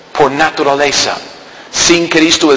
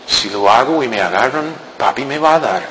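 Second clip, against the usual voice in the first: put-on softer, lower, sneaky child's inner voice; child's thinking; 6 semitones lower, 7 dB quieter than usual. Preacher voices a child weighing a sin, hushed and sneaky. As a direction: voice a tempted child plotting under the breath. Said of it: "Pensando como niño".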